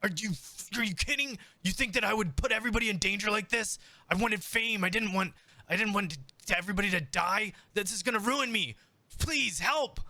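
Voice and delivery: high, fast, pleading voice of a frightened showman; accused showman begging for help, high and frantic; panicked Chaz. Acted. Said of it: "he says".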